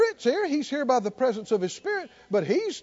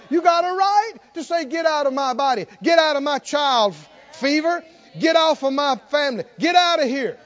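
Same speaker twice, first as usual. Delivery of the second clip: loud, raised pulpit exhortation; full-force preaching; 6 semitones higher, 6 dB louder than usual. Real, not acted. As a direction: command evil out with authority.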